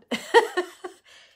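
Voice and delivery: high, loud burst of happy laughter; joyful laugh. Real, not acted. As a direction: laugh out in sheer relief. Joy after feeling God's presence.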